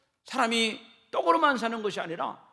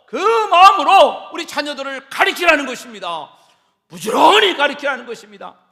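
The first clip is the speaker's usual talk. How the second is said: preaching shout; 3 semitones higher, 15 dB louder than usual. Acted. Preacher exhorting parents.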